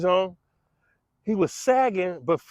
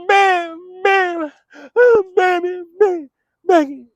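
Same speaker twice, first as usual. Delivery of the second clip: much higher, much louder mock calling; deaf brothers calling; 13 semitones higher, 9 dB louder, much slower than usual. Brothers calling their sister Becky, loud mock call. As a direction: belt out the name like the deaf brothers.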